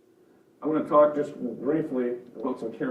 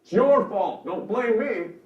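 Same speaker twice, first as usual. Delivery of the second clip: put-on higher, defensive child complaining; emotional, accusing whine, pitched up; whiny child voice; 5 semitones higher, 6 dB louder than usual. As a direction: play a victimized kid lashing out. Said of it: "kind of whiny".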